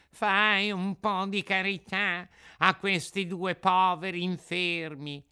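high, sing-song beggar's plea; whining fox. Acted. Fox begs charity for two invalids, whining. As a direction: wheedle for alms in a wail. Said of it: "con voce di piagnisteo".

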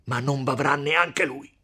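distraught, furious threat; frenzied shout. A narrator's reading. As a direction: shout wildly in jealous rage. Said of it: "gridò Renzo con un viso più che mai stravolto".

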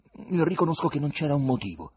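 flustered confession; troubled admission. A narrator's reading. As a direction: concede it, upset. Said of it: "rispose Ivan turbato".

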